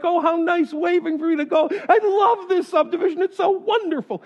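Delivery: high, gushing delight; mock delight. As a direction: gush over the friendly neighbor, pitched high. Delighted at neighbor waving.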